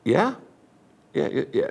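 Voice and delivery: softly voiced, questioning listener; a hint of puzzled acknowledgement; puzzled neighbor. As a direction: play the wary neighbor, quiet and rising. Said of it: "And he goes".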